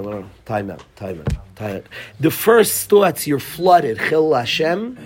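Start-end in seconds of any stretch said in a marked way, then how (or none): 0.0-1.9 much lower and softer, telling yourself to stop; low, hushed inner voice pulling back; calming self-talk. Retold or imitated. Father in a store telling himself to stop, low and hushed.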